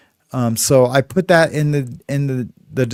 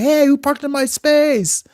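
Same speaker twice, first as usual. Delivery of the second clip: high-pitched mock yell, no extra volume; imitated yelling; 13 semitones higher than usual. Tenant retelling his complaint about the parking space, mock yelling. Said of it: "So I was, like, yelling".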